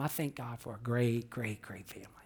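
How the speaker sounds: soft, fading into a whisper on great family; a quiet, sincere thank you to God; hushed thanks. Real, not acted. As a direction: whisper the thanks, almost to yourself.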